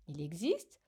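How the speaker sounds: ordinary talk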